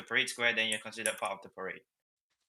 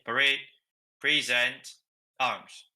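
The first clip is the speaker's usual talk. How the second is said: loud barked parade order; drill command; 5 dB louder, much slower than usual. Shown as a demonstration. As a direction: call each word out across the square. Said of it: "I'm always going to drag out the middle".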